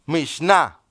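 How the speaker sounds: Mishnah set apart, stressed on the final A; Mishnah as a new word, weight on its last syllable; teaching pronunciation. Shown as a demonstration. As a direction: model Mishnah carefully for learners. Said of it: "le voy a enseñar otra palabra hebrea".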